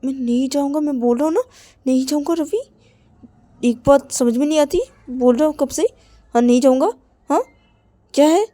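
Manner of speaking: loud, stubborn shouted refusal; loud defiant no, threatening at the end; Rahul angry refusal. Acted. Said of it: "Rahul बोलते".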